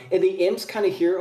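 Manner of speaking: ordinary talk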